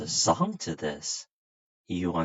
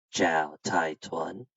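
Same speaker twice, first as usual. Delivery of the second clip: very slow and lower, drawn out; slow demo reply; 3 semitones lower, slower than usual. Shown as a demonstration. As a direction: model the answer slowly for the child. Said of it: "you say".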